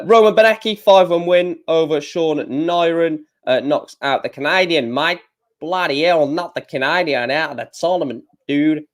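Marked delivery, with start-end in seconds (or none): 4.9-8.8 a crap mock Australian accent, lightly done; joking Australian accent, badly put on. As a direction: toss off a half-hearted Aussie voice. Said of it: "That is a crap accent".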